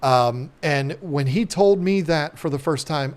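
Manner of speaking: ordinary talk, nothing marked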